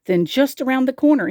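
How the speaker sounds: plain reading voice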